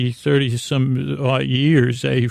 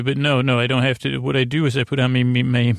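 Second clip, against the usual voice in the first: ordinary delivery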